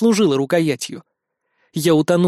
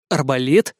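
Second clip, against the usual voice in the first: plain reading voice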